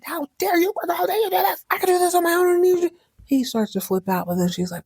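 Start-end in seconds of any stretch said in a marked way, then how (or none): 0.0-2.9 much higher and raised, hammed-up outrage; mock offended. Blake scolding Crystal over the check, hammed-up outrage. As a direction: play Blake's wounded pride over the top. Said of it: "He is offended".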